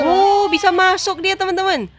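thrilled, drawing out the words; delighted surprise. Real, not acted. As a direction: exclaim at a lucky success. Baby lands in the hoop.

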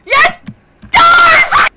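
triumphant shout. Real, not acted. Celebrating in game.

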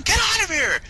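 high, loud yell to flee; hammed-up frantic warning; mock urgent shout. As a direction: scream it like a movie hero. Acted telling friend to run.

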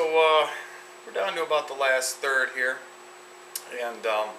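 words around cigar at lips; talking with cigar in mouth. Real, not acted. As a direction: lips closed round cigar. Seen 3: cigar held at lips through most words, lowered by 4.2.

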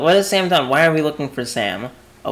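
normal talking voice

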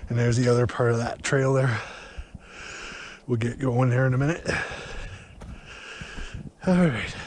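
breathing heavily while walking; puffing between words. Real, not acted. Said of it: "Sorry for the heavy breathing".

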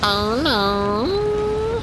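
high-pitched mock "oh no"; high mock dread. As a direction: squeak out a fake panic.

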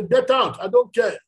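shouting rudely at the churchgoer. Retold or imitated. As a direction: bark it out, brushing him off.

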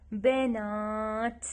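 بنات said very slowly, ending on a clear ت; slow teaching word. Shown as a demonstration. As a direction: stretch بنات for children. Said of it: "ت، حرف ت".

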